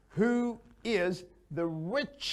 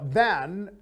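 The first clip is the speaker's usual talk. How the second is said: "than" said louder and held with a rising tune; teacher hits "than" loudly with a rise; stressed word; 6 dB louder than usual. Shown as a demonstration. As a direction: lean on "than" so students notice it. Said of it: "We use".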